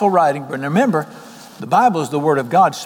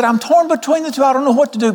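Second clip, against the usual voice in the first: high-pitched, exclaimed dilemma; acted torn indecision; 9 semitones higher than usual. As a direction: play a man pulled both ways, pitch raised. Acting Paul torn between two choices.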